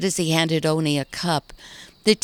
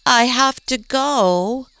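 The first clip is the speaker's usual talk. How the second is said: frowning; 7 semitones higher, 5 dB louder, slower than usual. Acted. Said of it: "the frown in Maggie's voice".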